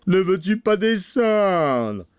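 slow, drawn-out angry holler; shouting. A narrator's reading. Bluebeard calling his wife down, shouting. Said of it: "criait la Barbe Bleue".

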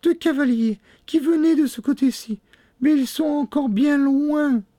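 very high falsetto call; exclaiming. A narrator's reading. Sister reporting from the tower, high exclamation. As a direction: cry out from the tower in a woman's voice. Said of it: "s'écria-t-elle".

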